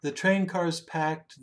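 ordinary delivery, nothing marked

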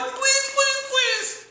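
high, loud pleading please please; a loud mock plea, pitched high; desperate begging. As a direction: beg frantically, voice way up. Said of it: "and is like".

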